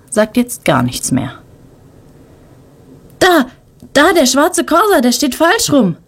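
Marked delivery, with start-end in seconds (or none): 3.2-6.0 high, startled outburst; blurted out. A narrator's reading. Boy spots the crashed car and blurts it out, high and startled. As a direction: blurt it out in shock. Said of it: "entfährt es Tim".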